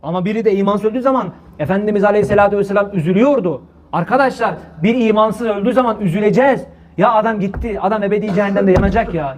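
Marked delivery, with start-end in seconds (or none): none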